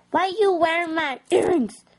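high put-on voice, pretend outrage; mock accusation. Acted quarrel over earrings.

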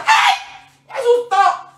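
very high startled shriek; hammed-up fright of a loopy teacher; imitating crazy teacher. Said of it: "Y ella era así".

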